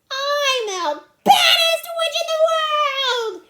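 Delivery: high, slow, very loud boast; yelling. Acted. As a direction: yell it drawn out like a child playing witch. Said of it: "yelled Ramona".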